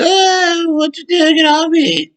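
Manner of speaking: very high, whiny squeal of a caught thief; mock crying thief. Package thief caught by the homeowner, mock crying squeal. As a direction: squeal and beg like you're caught and faking tears. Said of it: "got to crying and said".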